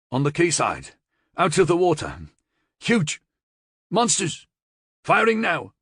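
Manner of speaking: high, loud frantic report from the front; panicked radio report. A narrator's reading. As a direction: shout it over gunfire in terror. Panicked report over radio speaker.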